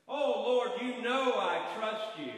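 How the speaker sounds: high loud slow eager pleading; eager assurance. Acted. Dangling man eagerly assures the Lord of his trust. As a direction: insist you trust him.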